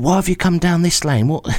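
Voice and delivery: high-pitched indignant complaint; moaning. Retold or imitated. Truck driver moaning at stranded customer, high indignant whinge. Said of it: "he starts moaning at me".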